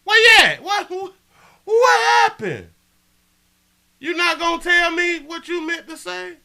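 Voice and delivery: high, loud taunting shout; mock shouting call-out. Real, not acted. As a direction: call the silent chatter out. Taunting chatter who went silent.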